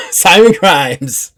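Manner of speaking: loud, high gleeful crowing; excited. Real, not acted. Game master crowing to players, loud and gleeful. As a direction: gloat that he's still alive. GM gleeful about surviving NPC.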